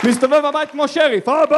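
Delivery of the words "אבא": put-on child calling out. Toddler calling out for dad.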